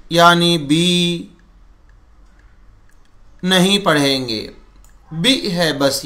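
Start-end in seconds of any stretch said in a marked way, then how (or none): 0.6-1.3 बी slowly stretched out long; mock stretched bee. Shown as a demonstration. Teacher demonstrates the wrong long बी, stretched. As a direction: draw बी out to show the error. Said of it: "खींचकर भी नहीं पढ़ेंगे".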